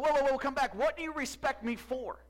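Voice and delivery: loud, high retold shout; calling her back. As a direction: imitate a husband urgently calling his wife back. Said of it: "he called her and said".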